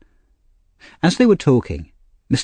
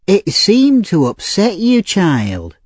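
put-on old lady; 8 semitones higher, slower than usual. A narrator's reading.

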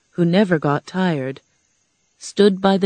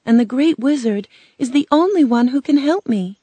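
put-on higher-pitched girl, bravely resolved; a plucky girl's voice, lifted; 7 semitones higher than usual. A narrator's reading. Girl tells of needing the Wizard's help, bravely. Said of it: "Dorothy said bravely".

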